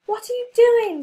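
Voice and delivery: high, loud indignant outburst; angrily. A narrator's reading. Clown protesting to the heroes, loud angry outburst. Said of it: "said the clown angrily".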